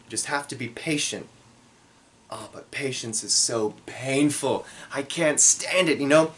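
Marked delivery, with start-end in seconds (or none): none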